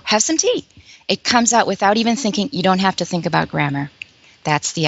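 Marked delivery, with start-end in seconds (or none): 0.0-0.6 friendly host offer. Retold or imitated. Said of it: "you go".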